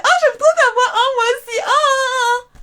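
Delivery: hammed-up high-pitched girlish swooning; gushing little girls. Sisters admiring each other's voices, mock gushing. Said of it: "Et on était".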